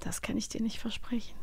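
soft teasing whisper. Real, not acted. Host whispering a teasing refusal to her cohost. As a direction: whisper it like a secret threat.

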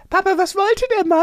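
very high-pitched imitation of a small child asking Papa; child's voice. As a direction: mimic a little kid piping up with a question. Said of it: "Und der Lille hat dann später auf dem Fahrrad gefragt".

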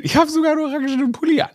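excited. Real, not acted. Excited aside about orange sweater.

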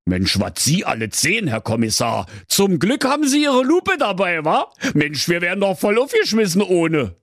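mildly awed king, rising to high excitement; a touch of gushing Berlin admiration; deeply impressed. A narrator's reading. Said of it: "rief tief beeindruckt".

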